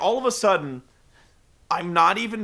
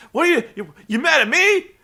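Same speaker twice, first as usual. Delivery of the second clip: high, loud, panicky begging with a laugh; hammed-up Woo at the end; Ric Flair impression; 13 semitones higher, 8 dB louder than usual. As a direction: squeal like a cowardly heel begging off.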